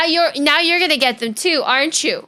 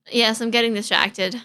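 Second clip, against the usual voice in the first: ordinary talk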